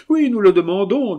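high, loud exclamation; insistent demand. Acted. Xavier pressing the marquise, loud and high. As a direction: demand an answer forcefully. Brother urgently demands the name.

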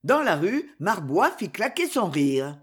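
usual speaking voice

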